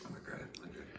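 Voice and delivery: talking with a mouthful of pasta; words around a bite of food; chewing while talking. Real, not acted. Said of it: "Gotta stop talking while I'm eating".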